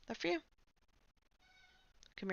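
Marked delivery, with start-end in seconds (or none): 0.1-0.4 pet talk. Real, not acted. Offering the cat treats.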